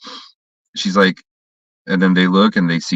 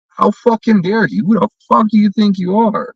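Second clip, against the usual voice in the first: high outraged yell; retold outrage; 12 semitones higher than usual. Snapping at the security girl, high outraged yell. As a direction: blow up in disbelief, pitched way up. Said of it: "and I'm like".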